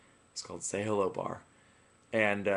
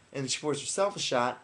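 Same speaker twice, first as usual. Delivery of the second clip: normal talking voice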